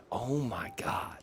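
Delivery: whispered "oh my god". Real, not acted. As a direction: breathe it out in awe. Reacting to ruined world.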